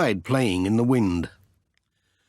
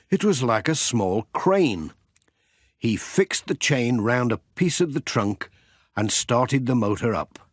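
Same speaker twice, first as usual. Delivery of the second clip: ordinary delivery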